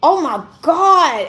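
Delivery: tearful oh my god. Retold or imitated. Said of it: "all I could do was cry".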